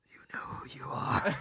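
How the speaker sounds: unvoiced teasing whisper. Real, not acted. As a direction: breathe it like a secret threat. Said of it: "teasing whisper at someone unnamed".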